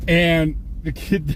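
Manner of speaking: normal talking voice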